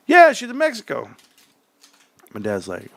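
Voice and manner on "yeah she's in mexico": put-on kid voice. Kids telling dad.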